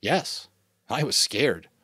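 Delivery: ordinary delivery, nothing marked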